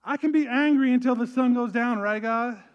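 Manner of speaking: mock petulant Jesus. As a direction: ham up a sulky complaint. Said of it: "So Jesus was like".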